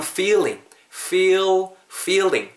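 "feeling" with clear F and long ee; high-pitched, stretched "feel", "feeling"; long F-word model. Shown as a demonstration. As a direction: hold the vowel in "feel", then "feeling". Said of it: "이렇게 발음해주시고".

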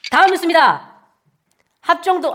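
formal anchor read, crisp and even; news anchor. Acted. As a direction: announce it like the evening news.

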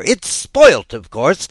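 high, loud, frantic anger; raging at his brother, high and loud; wild fury. A narrator's reading. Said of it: "stamp about wildly and tear his hair".

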